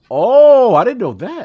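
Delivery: loud high surprised oh. Real, not acted. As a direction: react with real surprise. Surprised at news.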